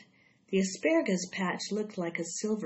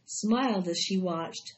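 usual speaking voice

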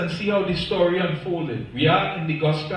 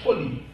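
fully said slow and falling; stress on fully; 3 semitones lower than usual. Shown as a demonstration. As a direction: lean on the word fully. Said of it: "I want to stress on the word".

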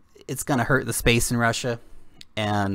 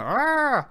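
mock frustrated cry; 13 semitones higher than usual. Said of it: "they keep going".

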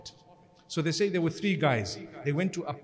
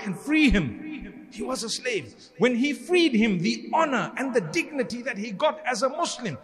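fervent preaching; 6 semitones higher than usual. Acted. Preacher telling the congregation about Bilal, fervent. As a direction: lift the crowd with rising fervour.

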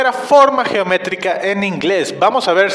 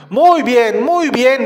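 higher, emphatic teacher praise; enthusiastic praise; 5 semitones higher, 4 dB louder, slower than usual. Real, not acted. Teacher praising children after practice, enthusiastic and higher-pitched. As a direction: cheer the pupils on.

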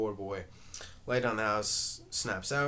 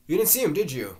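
loud, higher accusing demand; a louder, higher, angry accusation; accusing, angered; 7 semitones higher, 9 dB louder than usual. A narrator's reading. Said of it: "Willie, accusing, angered".

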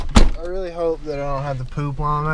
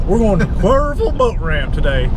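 put-on teasing copy of a country accent; mock country "Cuervo", syllables squashed together; higher, playful country-accent imitation; imitated country accent; 4 semitones higher than usual. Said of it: "your country way of saying that".

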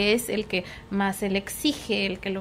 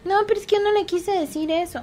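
put-on higher kid's protest; child defending; 7 semitones higher than usual. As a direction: protest like a kid. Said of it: "niño se defiende". (niño defendiéndose, más agudo)

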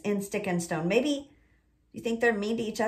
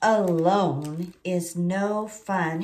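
slow storybook reading; 4 semitones lower, much slower than usual. Acted. Reading the book aloud.

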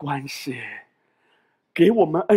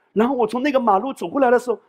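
usual speaking voice

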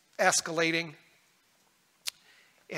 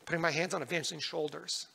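ordinary talk

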